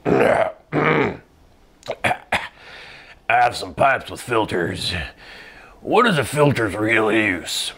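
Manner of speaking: thick, mucus-coated throat; phlegmy voice. Acted. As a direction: talk like your throat is full of milk. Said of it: "sounds as though I just, like, drank a gallon of milk and ate a block of cheese".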